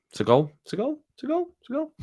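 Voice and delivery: hammed-up City fan rising cheer; mock fan celebration. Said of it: "they'd have been going".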